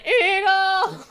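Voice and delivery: very high screeched bird call; eagle cry. Pretend. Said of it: "It just went".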